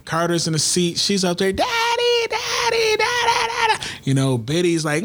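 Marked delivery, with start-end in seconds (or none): 1.5-3.8 put-on very high, wailing baby voice calling daddy; imitating crying toddler. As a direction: mimic a fussy little kid crying for daddy.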